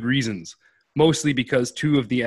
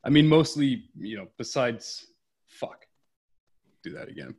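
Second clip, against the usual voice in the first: ordinary talk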